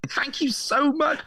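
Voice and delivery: high, slow comic squeak; silly high voice. Pretend. Guest repeats thanks in silly voice.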